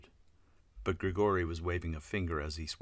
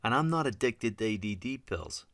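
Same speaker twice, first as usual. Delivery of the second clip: higher, slightly louder protest; indignant denial; 6 semitones higher, 4 dB louder than usual. A narrator's reading. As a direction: protest the accusation. Defensive denial in acted quarrel.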